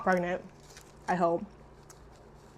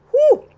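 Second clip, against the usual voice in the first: sharp high whoop of a whew; real loud whew of surprise; 10 dB louder than usual. Real, not acted. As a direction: let out a loud whew at the taste. Reacting to spicy liver.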